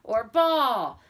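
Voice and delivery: high, loud "strike" and "ball"; umpire call. Retold or imitated. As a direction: bark the calls like an umpire. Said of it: "or he'll say".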